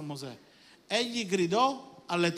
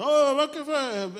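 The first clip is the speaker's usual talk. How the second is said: high, loud, drawn-out reproach; mock outraged protest; 8 semitones higher, 6 dB louder, slower than usual. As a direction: imitate someone loudly calling you out. Acts out someone's reproach.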